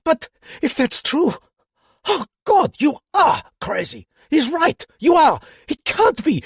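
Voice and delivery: trembling captain breaks down in horror; hysterical panic. Acted. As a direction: come apart in disbelief. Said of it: "who was trembling".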